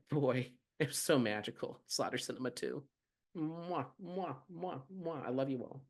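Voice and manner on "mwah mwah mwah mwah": airy high kissing sounds; blown kisses. Real, not acted. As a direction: blow soft kisses to the fans. Blowing kisses to listeners.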